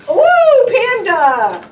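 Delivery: very high, loud whoop; delighted praise; excited cheer. Real, not acted. Cheering child success.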